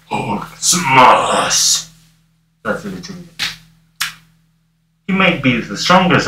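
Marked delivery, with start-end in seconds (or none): 0.1-1.9 slow, drawn-out angry impression; mock angry character. Mimicking the dumb, strongest Avenger when angry, slow mock rage. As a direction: drag it out in mock anger. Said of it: "When he gets angry, he always says one thing".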